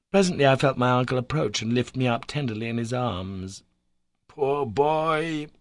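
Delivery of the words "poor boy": put-on higher, pitying murmur over the boy; a kindly old uncle's pitying sigh; deep commiseration. A narrator's reading. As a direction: feel for him, tender pity. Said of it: "in a tone of deep commiseration".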